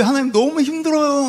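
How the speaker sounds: high, pleading complaint; plaintive cry. Acted. Job tells God it is too hard, plaintive cry. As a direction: whine upward to God in hardship. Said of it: "막 그랬더니".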